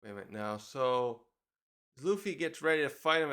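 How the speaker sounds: ordinary talk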